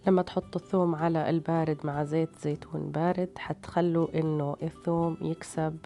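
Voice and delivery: ordinary talk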